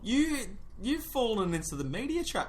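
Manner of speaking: high excited accusation; excited accusing. Real, not acted. Mock accusation, loud.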